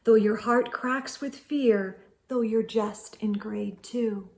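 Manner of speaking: gently whispered ending; soft whisper. A narrator's reading. Narrator tenderly whispering the lesson to children. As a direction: hush it tenderly.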